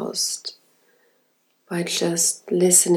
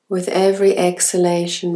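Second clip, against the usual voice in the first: normal talking voice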